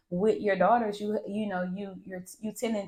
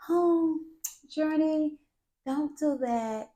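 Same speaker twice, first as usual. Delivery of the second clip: mock gentle parenting; 8 semitones higher, slower than usual. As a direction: coo it like an overly gentle mom. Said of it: "Gentle parenting my ass".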